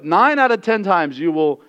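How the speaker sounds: normal talking voice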